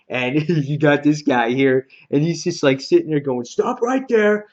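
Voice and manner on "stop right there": high, slow mock guard's order; imitated sentry, pitched well up; called-out challenge. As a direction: call out a halt from the wall. Acted guard challenging intruders in retelling.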